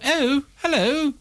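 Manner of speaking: high, drawn-out posh English "Oh, hello"; posh English accent. Retold or imitated. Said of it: "everyone has this stereotypical".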